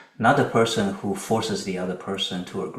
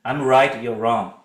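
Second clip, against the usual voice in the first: argumentative; 6 dB louder than usual. Shown as a demonstration. Acts out escalating argument.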